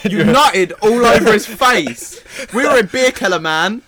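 loud, high shouted accusation; big hammed-up shout; mock outraged yelling. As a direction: yell it out as a public call-out. Joins in calling out absent person.

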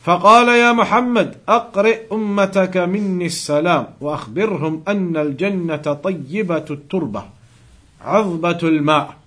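rapid, practised Arabic recitation; hadith recitation. Acted. Teacher reciting the Prophet's words to students, fast Arabic recitation. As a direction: rattle off the memorised text.

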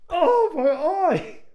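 mock wail of pain, stretched long; hammed-up pained cry. Girl hit in the eye.

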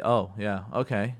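low, perfunctory mock sympathy; grumpy brush-off. Spouse half-listening to wife's hard day, grumpy token sympathy. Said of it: "not just to grump and say".

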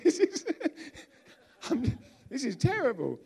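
high, loud laughing wail; mock dismay. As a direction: act out buyer's regret, cracking up in a high voice. Retold dismay after overpaying.

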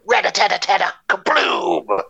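rapid rat-a-tat then a big boom; gunfire sound effects. Pretend. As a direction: mouth a machine gun and an explosion. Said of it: "I gotta do the sound effects".

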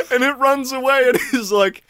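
ordinary delivery

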